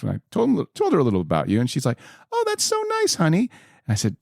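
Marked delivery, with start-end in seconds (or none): 2.3-3.5 imitating mom, much higher and sweet-sounding; a light high mom impression; mom's voice. Son voicing his mom praising the guest, high mock voice.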